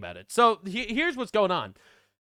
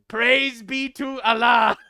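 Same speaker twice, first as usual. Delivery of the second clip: laughing; 3 semitones higher, 4 dB louder, much slower than usual. Real, not acted. Laughing at the post's ending.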